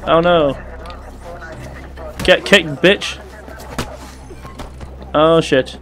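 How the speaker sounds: ordinary delivery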